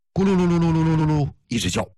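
playful belly-rumble sound; gurgling noise. Retold or imitated. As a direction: imitate a rumbling stomach with a rolling r. Imitates stomach gurgling sound.

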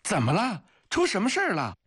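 very high-pitched alarmed questions; startled cry. A narrator's reading. Soldier hears a shot nearby, crying out in alarm. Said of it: "惊叫地问道".

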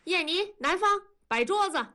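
mother calling out. Acted. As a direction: shout for the kids to set the table. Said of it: "文丽一声吆喝".

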